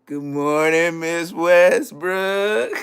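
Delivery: loud, hugely stretched singsong greeting; laid on thick. Retold or imitated. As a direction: overdo the pupil's greeting, rising at the end. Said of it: "he'll put it on thick".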